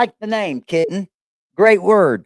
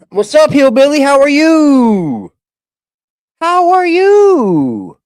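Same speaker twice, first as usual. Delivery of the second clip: loud, high, long drawn-out "you"; big sing-out greeting; hollered greeting; 5 semitones higher, 7 dB louder, slower than usual. Real, not acted. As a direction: holler hello with stretched vowels. Calling out greeting to viewer.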